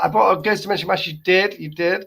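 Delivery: ordinary delivery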